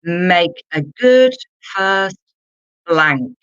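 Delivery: a drawn-out teacher read; slow, emphatic. Shown as a demonstration. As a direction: spell it out slowly for learners. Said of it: "Let's look at number two".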